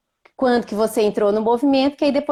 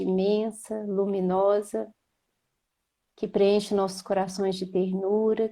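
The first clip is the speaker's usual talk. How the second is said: calm, unhurried prayer to Jesus, eyes closed; opening prayer; 4 dB quieter than usual. Acted. Praying to Jesus before the live starts, in a gentle prayer voice. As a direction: lead the group in a gentle prayer.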